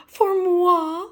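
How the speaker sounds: high, sweet and coy; sweet Miss Piggy voice. Acted. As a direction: coo it like a flattered diva. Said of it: "she asked sweetly".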